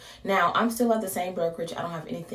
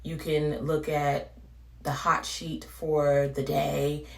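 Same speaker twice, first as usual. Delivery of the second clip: ordinary talk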